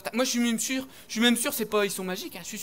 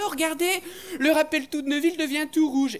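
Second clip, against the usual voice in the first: high, eager schoolboy voice; higher, louder schoolkid shout; excited kid; 8 semitones higher, 6 dB louder than usual. Acted. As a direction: point it out like a thrilled child. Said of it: "et un qui fait".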